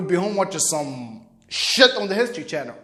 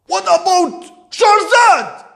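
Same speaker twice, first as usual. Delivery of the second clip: slow, high, indignant shout; outraged father; 10 semitones higher, 8 dB louder, much slower than usual. Retold or imitated. Father demanding about the woman he chose, indignant shout. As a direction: shout it, stretching each word.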